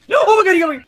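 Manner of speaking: high loud cry; panicked shout. Real, not acted. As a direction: shriek as you're caught. Caught by the enemy.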